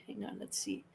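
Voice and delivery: whispered aside; whispering. Real, not acted. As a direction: whisper it under breath. Quiet aside while checking.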